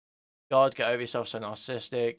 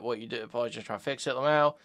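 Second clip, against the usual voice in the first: normal talking voice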